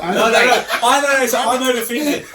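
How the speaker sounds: laughing high and loud; giggling through the words. Real, not acted.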